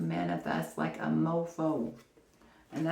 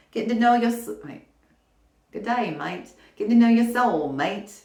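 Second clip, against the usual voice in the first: hammed-up Australian accent for a pun; bad Australian accent; 5 semitones higher, 7 dB louder than usual. Shown as a demonstration. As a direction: do a deliberately terrible Australian accent. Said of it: "like, the worst Australian accent".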